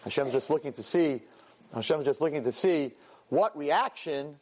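normal talking voice

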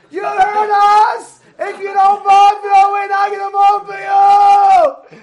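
very high, loud heckler on the mic; attention-grabbing yell. Retold or imitated. Lady seizing the mic to threaten the mayor, high shouting. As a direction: holler out with long stretched words. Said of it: "she needs to get attention somehow".

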